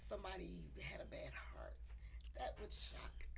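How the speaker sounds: hushed unvoiced whisper. Real, not acted. Friend whispering about a bad heart. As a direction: breathe it out like a secret.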